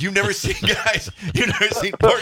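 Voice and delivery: high-pitched retelling through laughter; amused retelling. Retold or imitated. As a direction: tell it tickled, pitch climbing. Retelling the snake panic, laughing.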